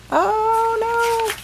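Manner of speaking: high squeaky oh no, pitched far up; a hammed-up shriek of dismay on the wolf's behalf; mock alarm. Wolf sees Red's ninja foot.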